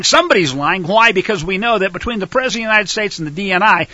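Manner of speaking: ordinary talk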